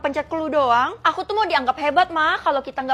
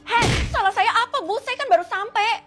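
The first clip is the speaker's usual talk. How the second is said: indignant protest; 7 semitones higher, 5 dB louder than usual. Acted. Protesting to the angry teacher.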